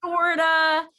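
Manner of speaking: high mock-doubtful kid, easing back down; sarcastic child. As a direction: imitate a son teasing his mom. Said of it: "he was just being sarcastic".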